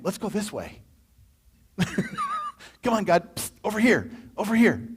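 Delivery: playfully tug at God to go your way; a hint of a pushy, whispered nudge; impatient self. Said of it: "I'm the one saying".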